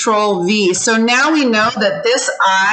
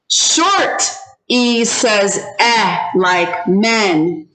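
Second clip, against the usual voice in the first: repeat-after-me model; much slower than usual. Shown as a demonstration. Teacher models a sound for students to repeat, slow repeat-after-me. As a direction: say it slowly for a class to copy. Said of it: "you're gonna repeat after me".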